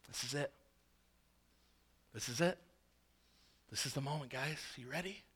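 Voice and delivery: very soft, low, hesitant aside; hushed huddle. Acted. Disciples check with each other before standing, hushed aside. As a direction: say it under your breath, with long pauses. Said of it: "look at each other and be like".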